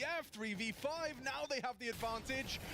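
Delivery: high, slowed-down hype call; excited play-by-play. Real, not acted. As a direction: build the hype on the swing. Caster hyped by the turnaround.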